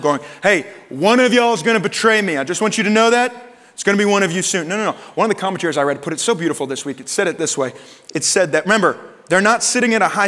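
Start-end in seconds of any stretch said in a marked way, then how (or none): 0.4-4.7 raised, louder mock announcement; announcing to a group. Jesus imagined addressing the whole table, loud announcement. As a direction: call it out over the whole group, pitched up. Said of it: "speaking this over the whole group".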